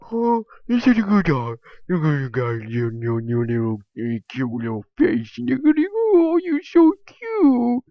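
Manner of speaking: put-on high, loud baby talk to a dog; cutesy dog voice. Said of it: "a cutie thing".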